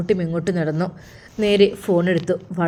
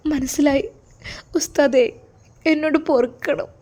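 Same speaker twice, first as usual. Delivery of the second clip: higher-pitched, tearful begging; acted tearful plea; 6 semitones higher than usual. A narrator's reading. Daughter begs the ustad to forgive her, tearful. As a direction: plead as if close to crying. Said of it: "എന്താ കുട്ടി നീ കരയുന്നത്".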